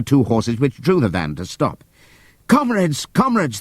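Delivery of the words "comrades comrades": high, pleading call; shouting. Acted. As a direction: plead with the horses. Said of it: "they shouted".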